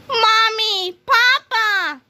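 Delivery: put-on high, childlike joyful cry; excited cry. A narrator's reading. Krupp running to his parents, high childlike cry. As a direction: call out like a little boy. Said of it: "cried Mister Krupp".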